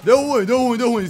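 alarmed shout. Real, not acted. Ambush in the game.

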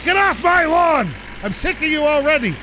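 high, loud cranky rant; mock grumpy neighbour yelling at punks. Real, not acted. Ranting about punks on road.

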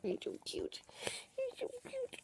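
put-on baby talk. Cooing at the pet.

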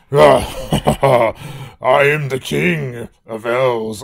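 slow, loud, lightly growled king; Bowser voice. Acted. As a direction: play a boastful monster king. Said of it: "It's Bowser time".

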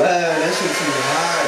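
usual speaking voice

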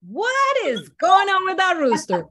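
high loud disbelief; mock outraged disbelief; incredulous. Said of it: "And I was like".

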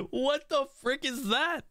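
very high, squeaky disbelief; incredulous outburst. Real, not acted. Gamer watching a random attack, squealing in disbelief. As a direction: squeal it in amused shock. Baffled by blue's random move.

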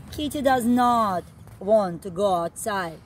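ordinary delivery

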